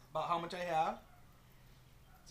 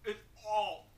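talking through a sticky mouthful; words thick with paste stuck to the palate; mouth full; 3 semitones higher, 4 dB louder than usual. Real, not acted. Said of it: "It's all stuck to my top of my mouth".

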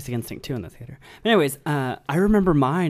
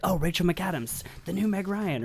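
higher-pitched mock buzz; mimicked hype; 7 semitones higher than usual. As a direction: imitate the crowd hyping a new star. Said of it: "It was like".

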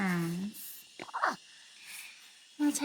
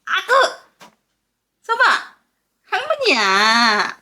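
크고 높은 목소리로 어르기; 손주를 반기듯 들떠서; excited cooing; 3 semitones higher, 8 dB louder, faster than usual. Real, not acted. Grandma cooing loudly at baby. (들뜬 목소리로 아기 어르기)

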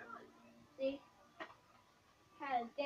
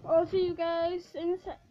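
loud, bright channel outro; vlog sign-off; 9 dB louder than usual. Acted. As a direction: hype up the goodbye to viewers.

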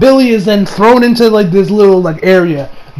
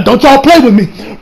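louder, higher mock warning; half-joking warning; 5 semitones higher, 4 dB louder than usual. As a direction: warn the filmmakers, half joking. Repeated warning.

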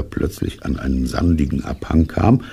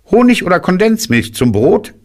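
very high, louder rabbit voice; rabbit character; 5 dB louder than usual. A narrator's reading. Rabbit offers bear a snack, high rabbit voice. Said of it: "und als Kaninchen sagte".

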